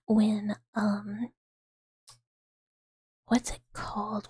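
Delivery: low, breathy near-whisper. Real, not acted. ASMR streamer chatting softly.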